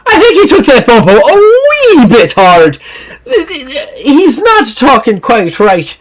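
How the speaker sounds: high, lilting Scottish voice with a laugh in it; high Scottish woman bluffing with a laugh; Scottish lass. Acted. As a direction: play a cheeky Scottish woman covering for him. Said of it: "Stands up and goes".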